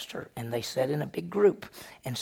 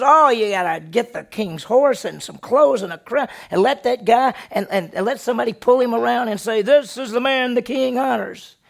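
loud, high, tumbling out his dream; big excited pitch to the king; excited boasting; 7 semitones higher, 10 dB louder than usual. Acted. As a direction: gush it out, picturing himself honored. Haman boasting his grand idea.

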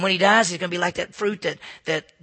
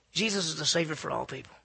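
ordinary talk, nothing marked

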